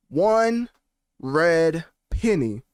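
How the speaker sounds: one pitched high, red penny slow and drawn out; dramatic emphasis. Acted. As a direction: hammer home the refusal like a villain. Reads [name] statement dramatically.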